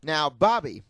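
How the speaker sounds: Chris Hansen. Acted. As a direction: confront him like a sting-show host. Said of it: "read them in his Chris Hansen-y voice".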